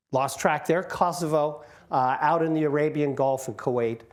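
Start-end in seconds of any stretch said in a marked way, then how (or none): none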